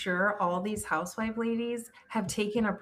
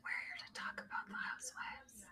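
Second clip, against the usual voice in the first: imitating a timid, whispered greeting; barely audible mock-shy hello; 20 dB quieter than usual. Podcast host mimicking their first podcast, shy whisper. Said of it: "'cause we were show shy".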